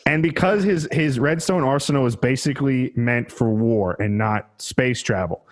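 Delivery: ordinary talk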